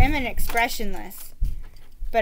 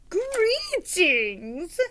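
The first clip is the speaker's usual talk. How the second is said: hailing greeting; 6 semitones higher than usual. Acted. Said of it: "Sir Fartsalot hailed".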